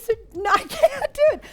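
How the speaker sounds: laughing refusal, high and loud. Real, not acted. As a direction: giggle through the refusal.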